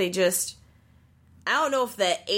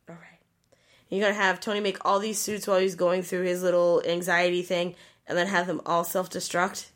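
normal talking voice

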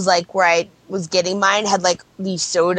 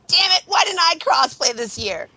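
exasperated; 6 semitones higher than usual. Retold or imitated. Acting out own regret.

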